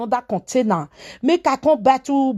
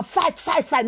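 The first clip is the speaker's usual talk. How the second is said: ordinary talk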